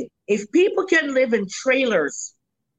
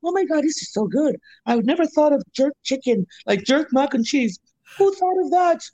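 higher, put-on Canadian accent imitating a customer; 4 semitones higher than usual. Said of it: "is that your Canadian accent that you throw on there".

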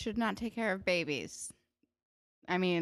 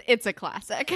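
mock-earnest quote, a bit louder; 2 semitones higher, 7 dB louder than usual. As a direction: quote it with total, irony-free earnestness. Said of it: "she's, like, so earnest".

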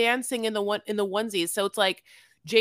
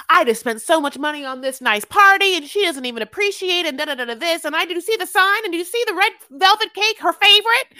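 loud, high-pitched rant, building to a peak; mock outraged complaint; 9 semitones higher, 8 dB louder than usual. Imagining her confessional about the party, mock outraged. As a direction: imitate a self-pitying whine for the camera, climbing higher and louder.

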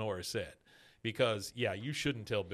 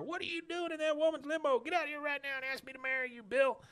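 much higher put-on woman's voice, frantic; jealous woman pounding on the limo window, much higher; Lenore demanding. Lenore outside the limo demanding Bill propose, much higher frantic voice.

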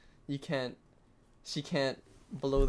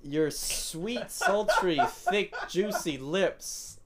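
mock sultry sleep-talk, slow; hammed-up dreamy murmuring; pretending to sleep; 3 semitones higher, much slower than usual. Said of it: "how I know you're fake sleeping is when you fake snore".

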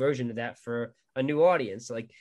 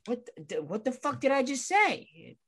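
high, slow, incredulous outburst; retelling how he reacted when his uncle ignored his no; exasperated disbelief; 10 semitones higher, 3 dB quieter than usual. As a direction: act out being totally fed up.

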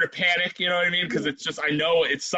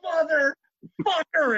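put-on kid cussing out; 9 semitones higher, much slower than usual. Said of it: "cuss him out".

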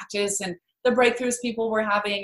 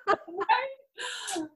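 burst of laughter; 8 semitones higher, 7 dB quieter than usual. Real, not acted. Laughing at her mom's reaction.

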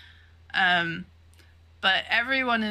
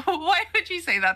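high, laughing dismay; real laugh in a mock-horrified question; laughing protest; 10 semitones higher than usual. Real, not acted. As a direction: protest through laughter, voice pitched up. Laughing protest at ominous remark.

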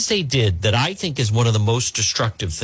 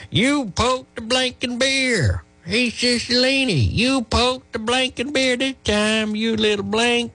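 put-on Southern accent caller; 13 semitones higher than usual. Host mimics a threatening caller with a Southern accent. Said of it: "a voice with a slight Southern accent".

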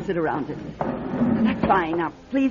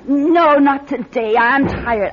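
loud, higher, irritated refusal; shouting someone away; 8 semitones higher, 8 dB louder than usual. Acted. Madame Natalia yelling at a visitor to go away, loud irritated shout. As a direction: call through the door to make them leave.

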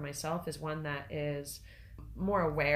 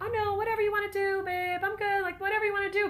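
much higher, quick, louder sing-song easygoing voice; mock people-pleaser; 5 dB louder than usual. Wife mocks her old self deferring to husband, high sing-song. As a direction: play a too-agreeable partner, hammed up high. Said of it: "but that's not who I am".

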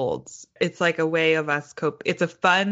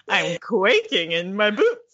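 slow, laughter-tinged delivery; drawn-out repeat; 3 semitones higher, slower than usual. Real, not acted. Co-host trying the idiom once more, drawn-out and playful. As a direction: stretch it out playfully.

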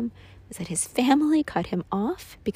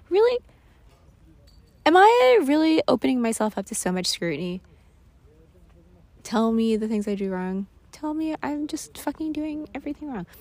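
indignant; 6 semitones higher, 5 dB louder than usual. Retold or imitated. Indignant quote, high and loud.

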